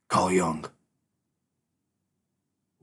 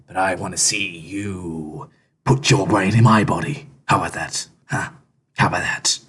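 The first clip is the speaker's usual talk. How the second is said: ordinary delivery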